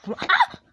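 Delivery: high shriek; startled scream. Real, not acted. Gamer shrieking at a sudden scare.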